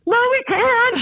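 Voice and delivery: high-pitched, slow, nervous squeak; squeaky high refusal. Retold or imitated. Retelling his nervous refusal.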